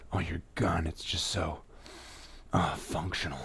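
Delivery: hushed lovestruck gushing; swooning admirer. Retold or imitated. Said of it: "And you're like".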